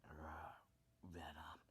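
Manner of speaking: dark, low-key growl; whispery dark monster voice; Venom. Shown as a demonstration. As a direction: growl it softly, dark and menacing. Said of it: "just think of a Venom voice".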